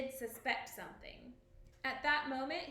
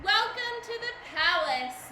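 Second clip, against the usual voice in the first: high, loud, cheerful announcement; cheerful welcome; 7 semitones higher, 10 dB louder than usual. A narrator's reading. King welcoming dinner guests, loud and cheerful. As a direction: greet the guests brightly. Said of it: "Macbeth announced cheerfully".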